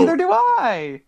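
loud, high exclamation; excited. Real, not acted. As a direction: blurt it out, delighted. Excited outburst, louder and higher.

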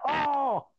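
high, drawn-out mock groan; retold pain wail. Wrestler snapping back into selling pain, hammed-up high wail. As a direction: moan as if selling an injury. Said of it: "and then goes back to".

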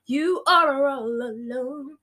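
slow, high, loud jeer; hammed-up drawn-out mockery; taunting. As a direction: drag every word out to rub it in. Depression rubs it in.

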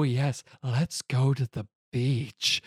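slow, drawn-out put-on voice; mock invitation. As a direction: stretch the words out in a put-on voice. Acting out a seductive beach invitation.

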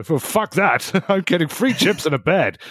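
high, cocky goblin-ish voice; smug glee about free chips; Gastro's greedy voice. Acted. Player voicing Gastro, high and smug.

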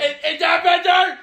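high-energy shout. Shown as a demonstration. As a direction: belt it out with exaggerated energy. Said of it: "I'm not gonna be low energy".

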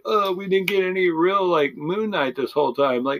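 high mocking voice of a complaining fan; mocking whiny critic. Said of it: "I don't care if they say".

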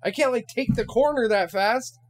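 high, loud indignant shouting; high-pitched furious rant at the stepmom; retold yell. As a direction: yell it back in a high, outraged voice. Yelling back at stepmom in story.

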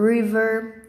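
"river" with an English R; English initial R. Shown as a demonstration. Teacher gives another R example, English initial R. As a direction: say "river" as RRIV-er, not HIV-er. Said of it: "E quando começa com R, a gente fala".

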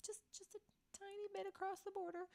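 high, soft little voice; tiny pleading voice. Real, not acted. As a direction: squeak it out small and quiet. Playfully minimizing the short trip.

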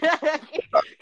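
loud burst of laughter; bright cackle. Real, not acted. Laughing at her own impression.

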